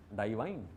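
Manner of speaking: "डाइवाइन" with "dai" at the start; अभिजात उच्चारण की नकल; mock elite pronunciation. Shown as a demonstration. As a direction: stretch the first vowel of "डाइवाइन" into "dai". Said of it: "डिवाइन को कैसे pronounce करेंगे?".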